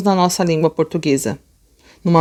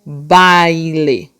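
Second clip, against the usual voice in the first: BAILE drawn right out; slow read-along; 2 semitones lower than usual. Shown as a demonstration. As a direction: stretch BAILE slowly so a class can join in. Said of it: "Vamos ler todo mundo junto, ó".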